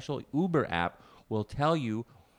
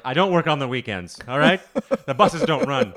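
louder, stubborn insistence; insistent brother; 4 semitones higher, 10 dB louder than usual. Retold or imitated. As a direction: plant your feet and insist, louder.

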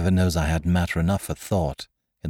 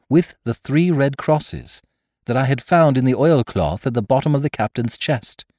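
plain reading voice

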